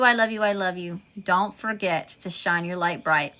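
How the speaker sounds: normal talking voice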